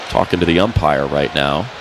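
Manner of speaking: ordinary talk, nothing marked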